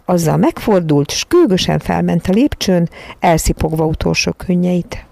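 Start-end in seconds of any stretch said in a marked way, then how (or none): none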